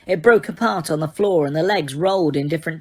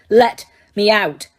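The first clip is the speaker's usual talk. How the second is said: slow, louder, forceful; insistent demand; 3 semitones higher, 6 dB louder, slower than usual. A narrator's reading. Harry repeating his demand, slow and louder. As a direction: press it harder, word by word. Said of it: "Harry said again".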